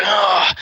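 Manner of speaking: loud, high "oh" of pain; pained groan. Retold or imitated. Storyteller acts rival kneed in the gut, pained cry. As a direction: imitate a man kneed in the gut.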